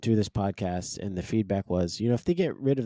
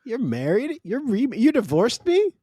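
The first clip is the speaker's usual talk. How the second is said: high-pitched, mock-aghast questions; shocked disbelief; 13 semitones higher, 5 dB louder than usual. Jilted man on the phone to his ex, aghast. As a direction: play stunned at the news, voice shooting up. Acts out shocked ex in retold call.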